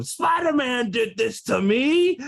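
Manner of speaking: high, drawn-out mock outrage; hammed-up villain wail of blame; villain's accusation. Comic retelling Spider-Man villains blaming him, mock-aggrieved.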